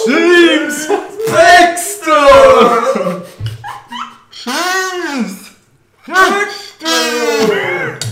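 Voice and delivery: loud calling out; shouting a name. Acted. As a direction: bellow the name across.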